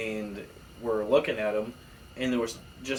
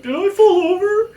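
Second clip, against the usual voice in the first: put-on much higher, louder confused boy; dazed kid voice; 13 dB louder than usual. Hit neighbor waking up confused, high dazed voice. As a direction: play the bonked kid waking up.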